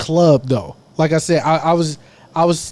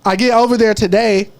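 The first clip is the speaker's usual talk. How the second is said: ordinary delivery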